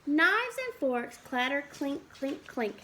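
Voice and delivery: put-on lively storybook read for little kids; reading to children. Teacher reads a farm book to the class, lively storybook voice. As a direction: read it out like a picture book at circle time.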